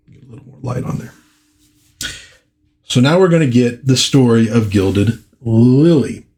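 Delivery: plain reading voice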